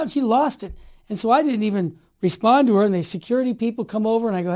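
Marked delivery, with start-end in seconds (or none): none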